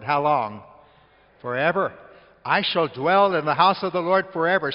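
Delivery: loud preaching shout; loud, fervent pulpit proclamation. Real, not acted. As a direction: call it out to the whole church.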